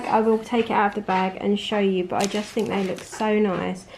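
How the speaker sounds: usual speaking voice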